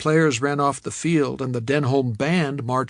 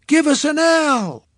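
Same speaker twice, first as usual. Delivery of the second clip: high, loud cheerleader shout; cheerleader call; 13 semitones higher, 6 dB louder than usual. Acted. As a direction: call out to the whole stadium. Cheerleader calls to crowd.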